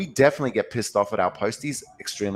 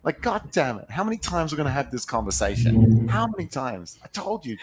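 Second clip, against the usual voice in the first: exasperated owner; 6 semitones higher than usual. Retold or imitated. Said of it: "You're like".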